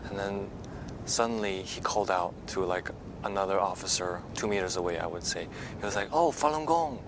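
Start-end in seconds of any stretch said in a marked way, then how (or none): none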